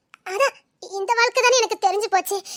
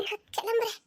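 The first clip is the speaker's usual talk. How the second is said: tearful; 12 dB quieter than usual. Acted. Wife leaving in tears.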